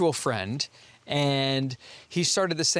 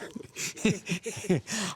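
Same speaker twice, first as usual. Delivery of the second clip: breaking into helpless laughter; real laugh; 8 semitones higher, 4 dB quieter than usual. Real, not acted. Cracking up mid-answer.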